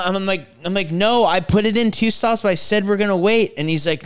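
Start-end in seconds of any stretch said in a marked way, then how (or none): 0.9-3.5 loud, high angry insisting; retold yelling. Passenger yelling at the driver that they would wait. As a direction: yell your point at the driver. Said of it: "I'm yelling at this guy".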